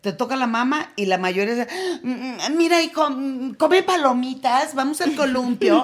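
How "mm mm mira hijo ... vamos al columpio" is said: higher, louder nervous hum, then a fast change of subject; an embarrassed mother dodging with forced cheer; mock-nervous mom steering her kid to popcorn; flustered mom. Mother dodges child's awkward question.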